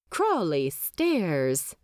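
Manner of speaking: plain reading voice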